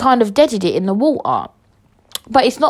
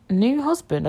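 higher, questioning echo of "new husband"; querying own words; 4 semitones higher, 4 dB quieter than usual. Real, not acted. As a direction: lift "new" in disbelief. Queries own slip, never had one.

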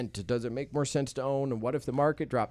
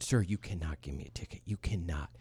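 quiet pleading; 7 semitones lower, 8 dB quieter than usual. Retold or imitated. Pleading with the cop.

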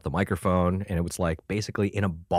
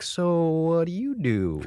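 higher, slow careful question; tentative question; 10 semitones higher, slower than usual. Retold or imitated. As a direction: ask him warily. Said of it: "I'm like".

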